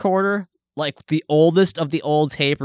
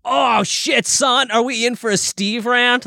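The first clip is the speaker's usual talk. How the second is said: excited; 8 semitones higher than usual. Real, not acted. Friend announces a rant coming.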